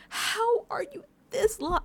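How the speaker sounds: high, drawn-out disbelief; incredulous. Retold or imitated. Viewer reacting to lost contestants, incredulous. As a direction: stretch out an exasperated question high.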